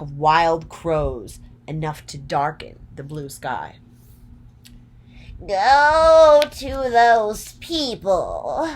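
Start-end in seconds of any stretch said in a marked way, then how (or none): 5.5-8.7 high, loud, slow witch voice; wicked witch command. A narrator's reading. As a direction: stretch each word like a cackling witch giving orders. Wicked Witch commands her wolves.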